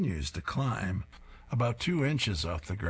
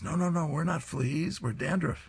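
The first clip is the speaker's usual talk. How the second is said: higher squeaky little bug voice; small flea pleading innocence; tiny flea voice; 9 semitones higher than usual. Acted. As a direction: pitch up for the fleas.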